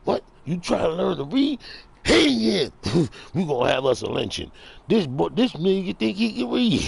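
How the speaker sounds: high, loud, outraged mock voice; hammed-up high-pitched indignation. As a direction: crow it high and incredulous.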